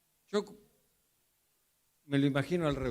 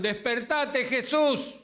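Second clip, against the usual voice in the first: mock shout; 8 semitones higher, slower than usual. Disciples shouting to wake the sleeping Jesus. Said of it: "Y ellos gritaron".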